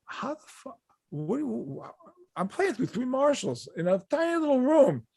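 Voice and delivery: high, strained disbelief; high-pitched mock exasperation; acted incredulity. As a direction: start hushed and sputtering, then build in exasperation. Acts out his exasperated reply to heckler.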